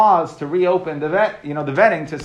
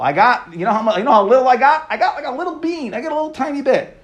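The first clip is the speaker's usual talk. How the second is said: high, whining complaint about a tiny share; whiny complaining priest; 7 semitones higher than usual. Retold or imitated. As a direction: whine like a kid cheated of cake. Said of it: "they were complaining".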